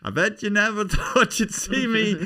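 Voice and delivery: high, loud, insistent Irish comic voice; Tommy Nicholson. Acted. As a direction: pester the star with excited memories. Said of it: "That's Tommy Nicholson".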